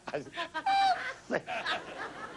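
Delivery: breathless high giggle; wheezing laugh; airy high laugh. Real, not acted. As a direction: cackle off silently and high.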